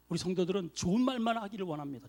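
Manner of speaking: ordinary delivery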